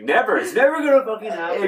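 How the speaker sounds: loud, high mock outburst; hammed-up indignant no; emphatic refusal. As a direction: refuse at the top of your voice. Acting out refusal to watch.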